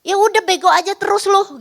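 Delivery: imitating a parent snapping insults; cursing parent. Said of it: "kayak nyumpahin anak kita". (orang tua memaki anak dengan kesal)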